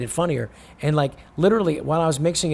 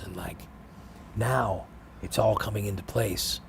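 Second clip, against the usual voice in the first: hushed, serene, much softer voice; a calm guru talking softly; soft-spoken Seagal; 5 semitones lower, 8 dB quieter than usual. Retold or imitated. Seagal retold, soft spiritual.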